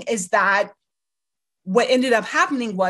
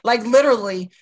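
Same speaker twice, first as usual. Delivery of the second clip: ordinary talk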